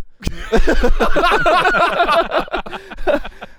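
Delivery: high, drawn-out laughing fit; long laugh. Real, not acted. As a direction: crack up at the story. Group laughs at retold reaction.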